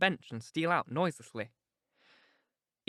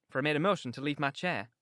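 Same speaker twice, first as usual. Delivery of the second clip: ordinary delivery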